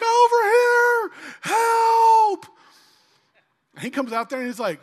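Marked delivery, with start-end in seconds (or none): 0.0-2.5 high, loud, drawn-out plea; panicked cry for help. Retold or imitated. Scared boy calling to dad from tree, panicked yell.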